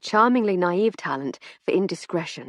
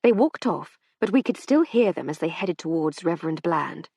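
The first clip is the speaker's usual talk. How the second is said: usual speaking voice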